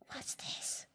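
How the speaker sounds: breathy suspense whisper. A narrator's reading. Reader building suspense, whispered. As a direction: hush it like a secret.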